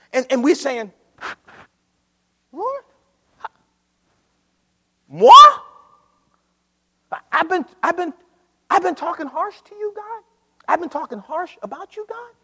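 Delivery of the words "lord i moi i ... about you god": higher, wide-eyed feigned innocence; mock innocent protest. Church protesting innocence to God, hammed-up higher voice. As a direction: play the church pretending it did nothing wrong.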